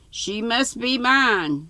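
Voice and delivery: high, slow, insistent villain; hammed-up stern demand; stern snap. A narrator's reading. Squire insists to Stubbs on having the girl, stern and slow. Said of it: "sternly snapped".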